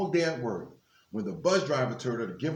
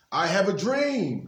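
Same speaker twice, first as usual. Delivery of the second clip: 1 proclaiming a dream; 8 semitones higher, 7 dB louder than usual. A narrator's reading. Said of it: "he told them".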